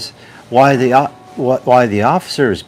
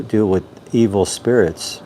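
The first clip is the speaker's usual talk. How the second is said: ordinary delivery, nothing marked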